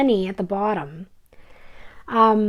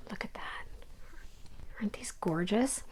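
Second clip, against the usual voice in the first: awed whisper; 17 dB quieter than usual. Pretend. Stitcher showing viewers her silks, whispering in awe. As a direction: hush as if the silks might break.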